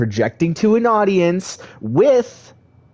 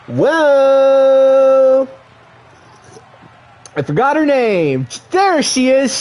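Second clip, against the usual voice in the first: excited wrestling announcer; play-by-play commentary; 6 semitones higher than usual. Acted. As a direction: call the match like a ringside commentator.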